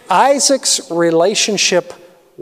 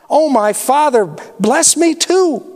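much higher, breaking plea on bless me too; pleading cry; 7 semitones higher than usual. Acted. Esau pleads again before breaking down, high tearful cry. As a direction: cry it out as he is about to weep. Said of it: "Esau pleaded".

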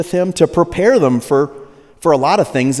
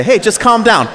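mock dismissive; 7 semitones higher, 4 dB louder than usual. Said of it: "when you say".

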